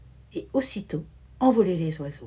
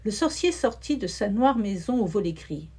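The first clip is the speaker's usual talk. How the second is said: ordinary delivery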